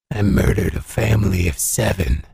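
very low, menacing inmate voice; deep, cold murderer; hardened killer. Acted. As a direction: confess it in a deep convict voice. Hardened killer answers in prison.

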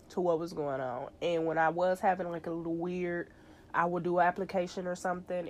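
ordinary talk

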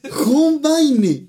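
"John Wayne" high-pitched as Jon Báine; mocking hispanicised pronunciation. Friend mocking the grandfather's version, high hispanicised John Wayne. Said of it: "mi abuelo decía John Wayne".

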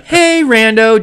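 high, slow drawn-out hello; mom greeting. Retold or imitated. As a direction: mimic mom's casual greeting. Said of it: "just like that, like a normal conversation".

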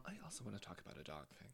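soft unvoiced whisper. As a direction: whisper the ad intro. Whispered ad lead-in.

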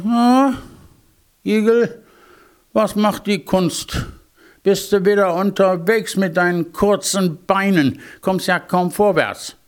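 high-pitched, haughty hare voice; arrogant hare. A narrator's reading. Hare taunts the hedgehog about short legs, arrogantly. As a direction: tease from on high, conceited. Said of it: "das war ein ziemlich arroganter Bursche".

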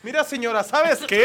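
high, loud mock stern scold with laughter. Customer telling off the slow cook, mock stern and loud. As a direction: scold the lady, hamming up the gravity. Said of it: "demuestra gravedad".

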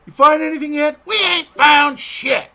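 high, loud goofy crewman; comedic crewman voice. Retold or imitated. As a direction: bark it like a dumb henchman. Quoting Spaceballs crewman line.

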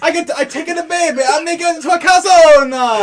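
a hint of a cartoon Italian cook; mock Italian accent. Joking about calzones in a light Italian accent. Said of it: "fucking awful Italian accents".

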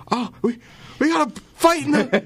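very high-pitched, stammering commentator panic; mock excited commentator. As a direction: imitate a startled broadcaster breaking into the game. Imitates broadcast cutting to a fight.

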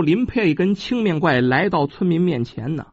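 ordinary delivery

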